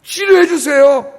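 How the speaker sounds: high, loud, begging cry to God; 절박하게 매달리는 기도; desperate pleading prayer. Shown as a demonstration. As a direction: plead for your life, voice rising. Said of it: "기도는 이렇게 하는 거예요".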